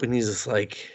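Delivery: ordinary talk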